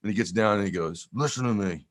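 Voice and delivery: lightly voiced stern father; a hint of a dad giving a lesson; father telling his son to fight back, mildly stern; tough dad. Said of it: "my dad goes".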